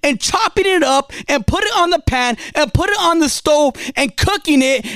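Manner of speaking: normal talking voice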